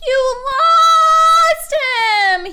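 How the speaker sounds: long, drawn-out high wail; loud, very high anguished yell; accusing yell. A narrator's reading. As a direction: yell it in outrage, stretching it out. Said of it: "he yelled at Judy".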